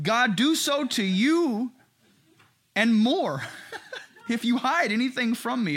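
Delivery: hammed-up menacing proclamation; threatening oath. Acting out Eli's threatening oath.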